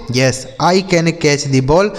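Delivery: slow, careful English question; slow model reading. Shown as a demonstration. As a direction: read it out slowly for learners. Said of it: "slow model answer".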